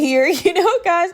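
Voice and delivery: breaks into a laugh, pitch jumping up. Real, not acted. Laughing to podcast listeners about her dream list. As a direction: giggle through the words as the list gets absurd.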